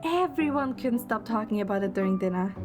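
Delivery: ordinary talk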